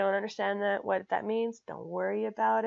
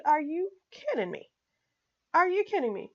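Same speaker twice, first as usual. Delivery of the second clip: incredulous, higher, indignant repeat; retold outrage; 8 semitones higher, 4 dB louder than usual. Reader fuming at a finance book, indignant outburst. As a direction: replay the moment of disbelief at the book. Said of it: "I started cursing. I said".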